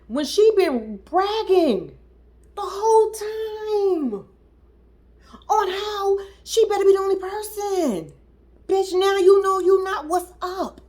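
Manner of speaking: high, slow, drawn-out disbelief; exasperated. Real, not acted. Viewer complains that the bragging woman stays calm, exasperated. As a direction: stretch each phrase out high in exasperation.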